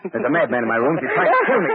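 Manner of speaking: loud, climbing into a shrieking high pitch; panicked plea. Acted. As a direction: scream for help as the gun comes up. Phones police at gunpoint.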